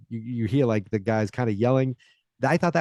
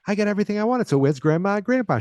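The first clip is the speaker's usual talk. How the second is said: put-on high, bright-eyed child on Christmas morning; excited kid; 10 semitones higher, faster than usual. Heather at the tree on Christmas morning, high and excited. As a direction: gush it like a thrilled little girl. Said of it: "And she goes".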